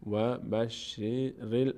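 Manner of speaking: very slow, drawn-out "بشریل"; joined-syllable recitation. Shown as a demonstration. Teacher linking ra to lam, slow syllable-by-syllable recitation. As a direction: join the r to the l slowly, syllable by syllable. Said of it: "ر میچسبه به لام".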